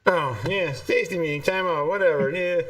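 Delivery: normal talking voice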